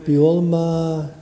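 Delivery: slow, measured prayer recitation; Kaddish recitation. Acted. Rabbi leading mourners in the Kaddish, slow prayer chant. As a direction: lead the mourners through the Kaddish, unhurried.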